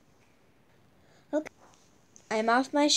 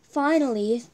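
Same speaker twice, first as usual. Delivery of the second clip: usual speaking voice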